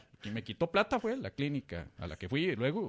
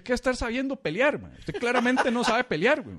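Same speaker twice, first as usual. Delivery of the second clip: mocking barber; 9 semitones higher, 4 dB louder than usual. Barber mocking his fighting.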